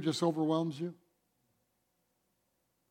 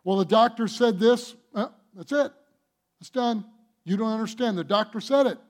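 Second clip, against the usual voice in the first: raised, insistent doubting voice; voicing a doubter; 4 semitones higher, 4 dB louder than usual. Preacher imitating a worried believer quoting the doctor, insistent. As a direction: argue that the verdict is final.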